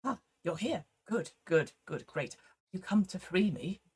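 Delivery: soft, fading, timid doll voice; hushed, halting little figure; small Ken doll. Acted. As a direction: greet a rescuer very quietly.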